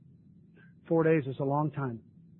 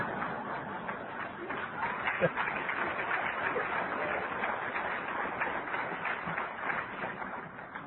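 laugh; 7 dB quieter than usual. Real, not acted. Audience hears his joke.

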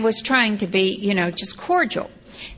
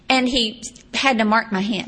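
normal talking voice

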